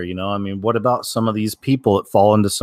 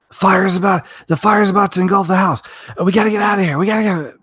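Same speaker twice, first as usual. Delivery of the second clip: frantic yelling parent; 11 semitones higher than usual. Retold or imitated. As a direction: yell at the kid to escape the fire. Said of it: "could you use a nicer tone?".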